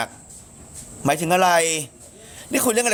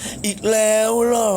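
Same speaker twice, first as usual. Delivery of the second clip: hammed-up shock, drawn out slowly; high, slow imitation of disbelief; Num; 7 semitones higher, slower than usual. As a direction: stretch the startled question out high. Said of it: "เหี้ย พี่หนุ่มตกใจน่ากลัวมากเลย".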